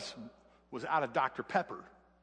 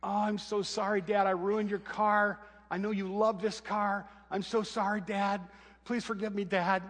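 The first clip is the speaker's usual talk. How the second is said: higher, slow pleading apology, mildly imitated; tearful apology; 6 semitones higher than usual. Daughter apologizing to her dad after wrecking his car, tearful. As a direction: beg forgiveness through tears, lightly voiced. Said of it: "just weeping".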